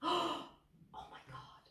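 breathless gasp into a slow whisper; awed whisper. Real, not acted. Catches sight of a variegated plant, stunned whisper.

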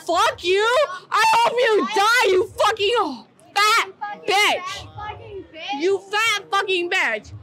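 a half-mocking shout of fury, with a crack of tears in it; retold angry yell. To the girl who ignored her texts, a retold angry shout. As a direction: spit the curses like you're reliving it.

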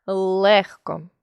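"легко" with the "ле" held long; stressed first syllable. Shown as a demonstration. Teacher showing a spelling check by stress, "легко" with lengthened "ле". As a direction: lean slowly on the "ле" in "легко". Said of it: "можна наголосом".

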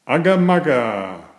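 ordinary delivery

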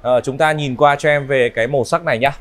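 ordinary talk, nothing marked

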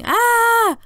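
high, loud "Ah!" yelp; mock scream. Boy retells his brother's scream, loud high yelp.

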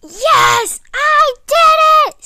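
loud, high shout of victory; triumphant cheer. Real, not acted. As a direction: cheer as you finally win. Finally beats the level.

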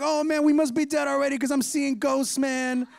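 a touch of hammed-up alarm; mock panic. Disciples thinking they see a ghost on the water, mildly panicked.

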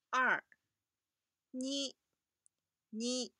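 二 said as Japanese ni, short and clear. Shown as a demonstration. Teacher modelling 二 as Japanese ni for students. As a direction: model 二 as ni, stressed the first time. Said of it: "这些数字的读音".

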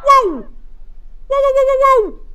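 very high, loud whoas; screaming in disbelief; shrieking whoa. Real, not acted. Shrieking at fast runner.

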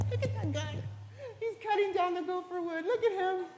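light mock ridicule; mocking onlooker. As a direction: scoff quietly at the ark builder. Mocking crowd laughing at Noah.

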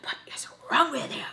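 hushed, breathy imitation of mom; mock mom in a whisper. Imitating mom's reply in a whisper.

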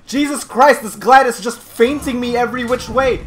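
loud, high exasperation; worked-up gamer outburst; frustrated. Real, not acted. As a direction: complain loudly about the opponent. Losing to gladiator.

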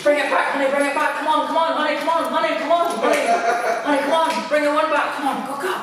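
coaxing dog in a high voice; high dog-calling voice. Real, not acted. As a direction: call the dog back, bright and eager.

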